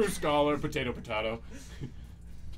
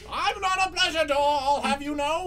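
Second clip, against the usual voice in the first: indignant door voice; 8 semitones higher than usual. Acted. Door character offended.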